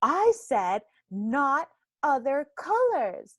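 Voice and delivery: high, loud, slow insistence; demanding toddler. Retold or imitated. Toddler correcting mama's drawing, demanding and exasperated. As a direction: imitate a bossy little kid, stressing each word.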